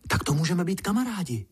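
fast, high little ant voice; blurted eagerly. A narrator's reading. Little ant offers friendship, blurting it out. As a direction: burst out quickly, pitched well up. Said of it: "vyhrkl mravenec".

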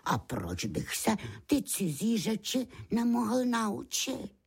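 high, hopeful lamb figure; little lamb's voice. A narrator's reading. Beránek přemýšlí nahlas. (vysoký hlásek beránka)

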